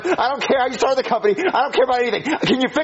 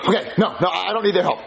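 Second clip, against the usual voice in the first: normal talking voice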